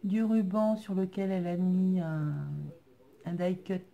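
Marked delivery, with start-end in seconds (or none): none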